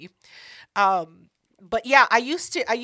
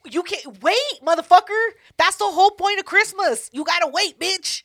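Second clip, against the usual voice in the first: higher, louder scold; 6 semitones higher, 5 dB louder than usual. Real, not acted. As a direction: chew them out with sass. Sister scolding the kids.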